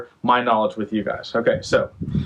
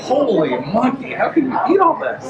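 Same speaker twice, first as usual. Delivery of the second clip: mock amazement; 14 semitones higher than usual. Reacting to someone ordering all the pancakes, high mock amazement. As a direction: gasp at the size of the order.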